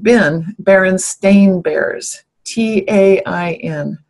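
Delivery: "Berenstain" said slowly and spelled T A I N; slow, letter by letter; spelling it out. Shown as a demonstration. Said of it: "now it's always".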